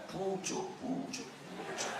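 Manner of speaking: whispered, slow and very soft; hushed whisper. Acted. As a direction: breathe the words like a secret, drawn out. Caterpillar nibbling shoots, hushed.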